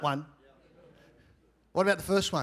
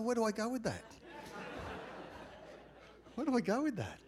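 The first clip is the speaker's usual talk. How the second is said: softer, high-pitched thinking aloud; inward dilemma; 6 semitones higher, 9 dB quieter, faster than usual. Retold or imitated. Pastor in the sauna wondering how to answer, soft inward dilemma. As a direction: puzzle it over under the conversation.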